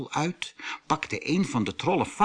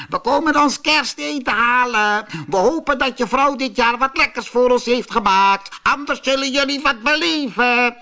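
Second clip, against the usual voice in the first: troll voice; 12 semitones higher, 6 dB louder than usual. A narrator's reading. Trolls demand their dinner.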